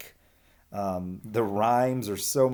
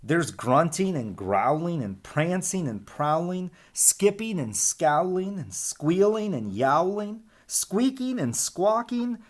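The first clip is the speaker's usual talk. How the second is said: building excitement; 8 semitones higher, slower than usual. Acted. Verb list builds in excitement.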